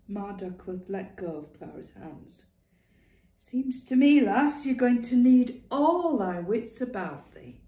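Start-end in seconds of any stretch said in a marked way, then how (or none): 3.4-7.6 put-on loud, higher old northern woman laying it down; a stern old Lancashire mother, emphatic; blunt old woman. Ma warning Clara she needs her wits, loud. Ma Duckworth voiced in story.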